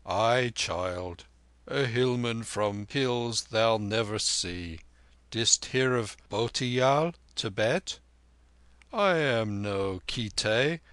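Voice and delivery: measured, emphatic elder; old Tibetan lama. Acted. Narrator plays the old lama.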